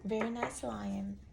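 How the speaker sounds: put-on friend's soft praise passed on to the toddler; gentle praise to a child. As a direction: relay the compliment softly to the boy. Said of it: "Miss Tammy said".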